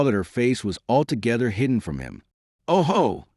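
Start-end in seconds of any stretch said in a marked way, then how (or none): 2.7-3.3 higher-pitched "oh ho" of discovery; exclaiming to himself. A narrator's reading. Quenouze to himself on noticing her hidden face, exclaiming. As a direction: lift it as a private exclamation. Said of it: "said Quenouze to himself".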